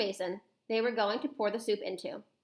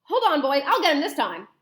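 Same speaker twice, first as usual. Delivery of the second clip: loud, high-pitched outcry settling into determination; startled cook shouting; 8 semitones higher, 8 dB louder than usual. Acted. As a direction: shout in alarm, then rally the others. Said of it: "the cook shouted".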